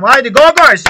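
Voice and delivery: very loud, high rally cry; pumping up the squad before kickoff, full shout; team talk shout. Pretend. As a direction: roar at the players in the locker room. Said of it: "Sahaya çıkın".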